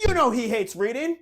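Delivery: loud, high scolding father; dad scolding. Retold or imitated. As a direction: scold the son with loud disbelief. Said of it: "And then he looks at me".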